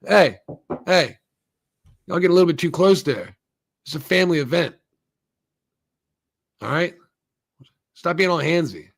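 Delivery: acted disapproving callout; mock scolding. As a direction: scold them like a nosy onlooker. Acting a man scolding the couple.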